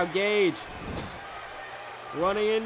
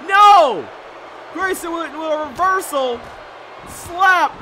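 high, loud live play-by-play; wrestling commentator; 5 semitones higher, 5 dB louder than usual. Real, not acted. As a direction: call the match like ringside.

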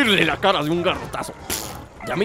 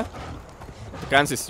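slow soft whisper; soft slow coaxing whisper; 4 semitones lower, 8 dB quieter, much slower than usual. Real, not acted. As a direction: whisper it slowly to the tired tiger. Coaxing tired mount.

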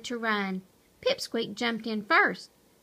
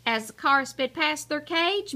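plain reading voice

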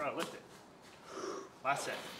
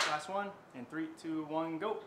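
normal talking voice

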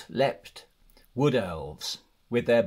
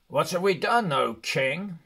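Balin protesting; 6 semitones higher than usual. Acted. Balin protesting to the elven king, in a raised indignant voice. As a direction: plead your case to the king, a touch of outrage. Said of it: "said Balin".

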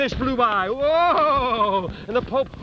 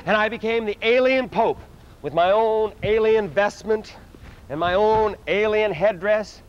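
normal talking voice

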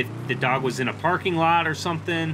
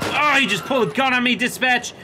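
agitated report; 10 semitones higher, 4 dB louder than usual. Acted. Reporting gunfire to dispatch.

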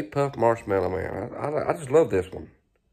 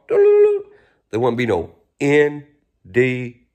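drawn-out show name; 6 dB louder, much slower than usual. Real, not acted.

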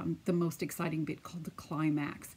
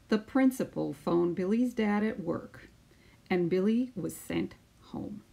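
put-on lively storytelling voice for children; picture book read-aloud; 4 semitones higher than usual. Teacher reading a picture book to her class, lively storytelling. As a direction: read it out like story time on the mat.

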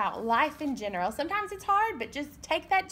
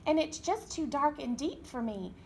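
ordinary delivery

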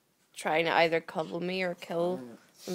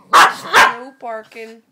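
slow, very loud "no barking"; loud command; 3 semitones higher, 20 dB louder, much slower than usual. Real, not acted. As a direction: bark the order out.

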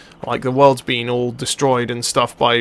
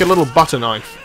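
ordinary talk, nothing marked

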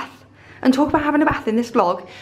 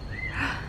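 gasp; 13 dB quieter than usual. Real, not acted. Gasps at balcony.

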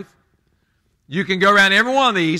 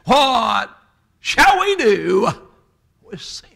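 higher, slow evangelist preacher call, fading at the end; Billy Graham again; 5 semitones higher, much slower than usual. Retold or imitated. Evangelist repeating his question, slow and drawn out. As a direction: stretch it out like a revival preacher. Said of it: "He said again".

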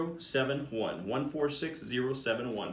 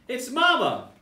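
high, louder surprised squeal; gleeful discovery; 10 semitones higher, 6 dB louder than usual. Acted. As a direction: catch her in the act. Monkeys discover mama giggling.

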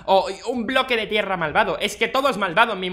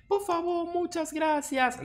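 much higher, softer, slow villager thanks; grateful villager; 11 semitones higher, 4 dB quieter, much slower than usual. Acted. Villager thanking the player for gifts, high and slow. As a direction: gush thanks like a poor villager.

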